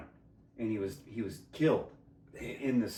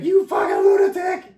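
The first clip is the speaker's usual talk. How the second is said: high, loud shrieked accusation; hammed-up high scream of a furious woman; mock shrieking woman; 12 dB louder, slower than usual.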